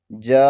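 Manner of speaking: "جا" held very long on one breath; long madd. Shown as a demonstration. Teacher repeats the big madd for viewers, prolonged "جا". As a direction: stretch "جا" out fully. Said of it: "اس کو کھینچا جائے گا".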